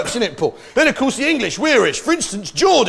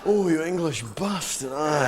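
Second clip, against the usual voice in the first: softer, lower Scottish moan of pleasure; pleasured Scotsman; 3 semitones lower, 7 dB quieter than usual. Acted. Comedian imitating Scotsman rubbed with chamois leather, blissful Scottish moan. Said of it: "sounds like he's having his genitals rubbed".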